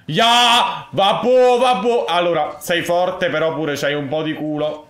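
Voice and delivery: high, loud frustrated yell; loud outburst. Real, not acted. Gamer yelling at a lucky opponent, loud outburst.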